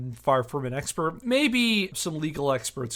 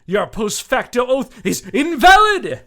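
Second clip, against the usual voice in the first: louder and higher, hammering the verdict; indignant shout; 9 semitones higher, 13 dB louder than usual. Acted. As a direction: bellow it like a final ruling. Indignant letter, peak accusation.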